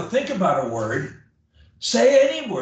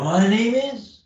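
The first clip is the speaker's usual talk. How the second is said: pitch back up; 6 semitones higher, 3 dB louder than usual. Shown as a demonstration. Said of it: "and come back up".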